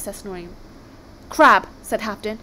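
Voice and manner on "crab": firm, louder contradiction; first round of a squabble, insistent but not yet shouting; arguing. Acted. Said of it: "They were so busy arguing".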